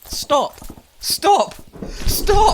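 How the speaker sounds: building panicked yells; rising shouts of stop. Acted. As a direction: yell stop harder every time. Shouting at a running horse.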